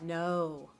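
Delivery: low, deadpan sarcastic no; sarcastic, dropped-pitch no; mock denial. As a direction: deny it drily, as an obvious joke. Sarcastic answer to sarcasm question.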